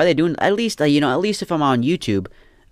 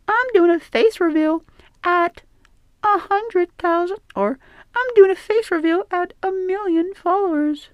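put-on very high falsetto girl voice; pretend woman; 3 dB louder than usual. Man pretending to be a woman on TikTok, high falsetto. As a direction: squeak it out like a coy influencer. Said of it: "shake your booty and be like".

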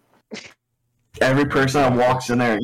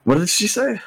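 startled; 8 semitones higher than usual. Retold or imitated. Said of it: "I'm like".

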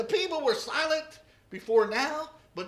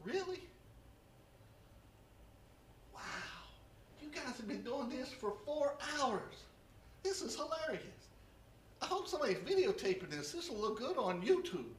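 amused aside; 9 dB quieter than usual. Acted. Elijah lounging, amused aside.

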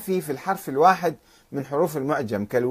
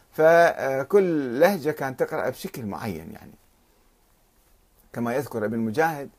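normal talking voice

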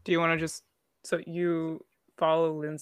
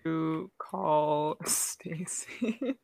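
whisper; 2 semitones lower, 5 dB quieter, much slower than usual. Real, not acted. Whispered aside.

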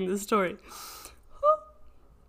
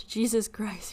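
usual speaking voice

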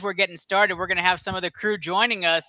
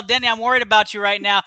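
ordinary delivery

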